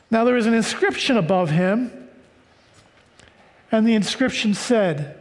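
usual speaking voice

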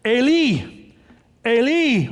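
a measured, projected cry; crying out loud. Acted. Preacher voicing Jesus crying out from the cross, mildly loud. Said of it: "I'm saying it loud".

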